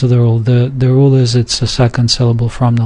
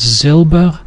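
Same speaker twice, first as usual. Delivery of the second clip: Silber stressed on "Sil"; original stem stress; 3 semitones higher than usual. Shown as a demonstration. Teacher showing the original word, stem stress. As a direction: lean on the first syllable of Silber. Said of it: "where it stayed in the original".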